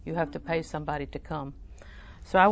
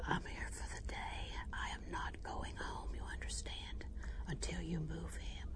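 firm whisper; 15 dB quieter than usual. Retold or imitated. To the desk lady, refusing to leave, a firm whisper. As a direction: whisper it, dead serious and immovable. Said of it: "And I whispered".

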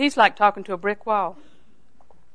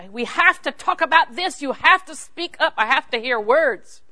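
exasperated plea; 5 semitones higher than usual. Retold or imitated. Pleading with silent husband, retold.

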